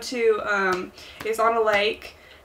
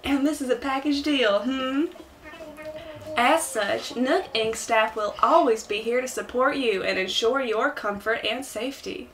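smooth Southern host reassuring newcomers; Tom Nook; 2 semitones higher, 4 dB quieter than usual. Acted. Promising support to new islanders, smooth Southern accent. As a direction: deliver the sales patter in a Southern accent. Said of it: "The Southern accent is supposed".

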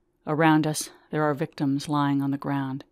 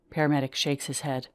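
ordinary delivery, nothing marked

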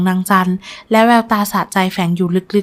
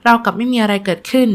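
ordinary delivery

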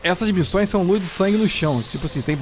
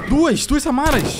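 surprised shout; 9 semitones higher, 3 dB louder, slower than usual. Real, not acted. Sees two enemies at once.

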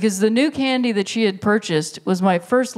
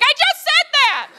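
very high-pitched exasperated shriek; hammed-up furious outburst; losing it; 4 dB louder than usual. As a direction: snap into a hysterical screech at the manager. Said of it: "She's losing her shit".

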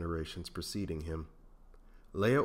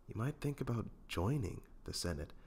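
softer, gentle suggestion; said quietly; 3 semitones higher, 7 dB quieter than usual. A narrator's reading. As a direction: float the idea softly. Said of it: "Luke said quietly".

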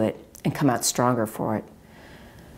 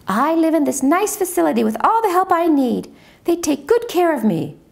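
much higher, cheerful resident voice; facility resident in video; 12 semitones higher than usual. Acted. Resident in the video praises the facility, much higher voice.

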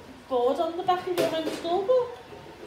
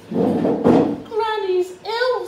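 mum voice; 13 semitones higher, 6 dB louder, much slower than usual. Acted.

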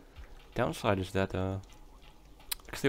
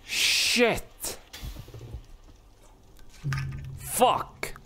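sharp high curses, each falling off; frustrated curses; 12 semitones higher than usual. Real, not acted. Gamer getting hit, cursing high and sharp.

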